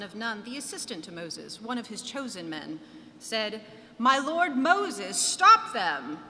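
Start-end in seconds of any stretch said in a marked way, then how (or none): 4.0-6.2 loud, slow, higher urgent cry; urgent plea. Acted. Joshua begging Moses to stop the prophets, loud urgent cry. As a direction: call out to Moses in alarm.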